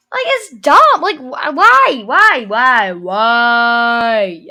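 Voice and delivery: loud exasperated string of whys; frustrated, pleading demand for a reason; exasperated venting. Real, not acted.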